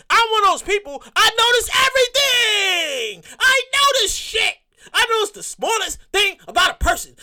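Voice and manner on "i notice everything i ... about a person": high, slow, half-crying shout; mock anguished rant. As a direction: wail it like a confession. Ranting, voice cracking high.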